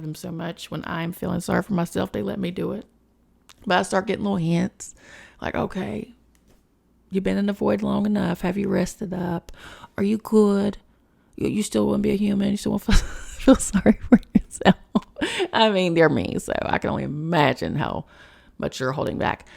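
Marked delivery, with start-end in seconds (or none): 5.5-14.7 coaxing guide voice, teasing at the end with a laugh; teasing spirit guides. Retold or imitated. Her spirit guides nudging her out of the void, teasing. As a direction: nudge her out of self-pity like a playful guide.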